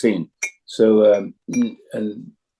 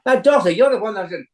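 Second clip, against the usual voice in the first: higher, Scottish lad voice; higher young Jamie arguing back; Jamie's voice; 10 semitones higher than usual. Acted. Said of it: "Fraser was actually arguing with himself".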